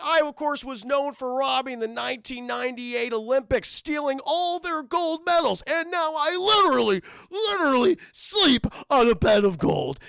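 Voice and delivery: high, loud bragging showman; boastful showman. Retold or imitated. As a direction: brag to the crowd like a stage star. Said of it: "he's like".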